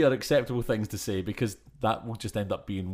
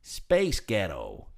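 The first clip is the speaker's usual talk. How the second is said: American accent; much slower than usual. Shown as a demonstration. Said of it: "any of you Americans out there".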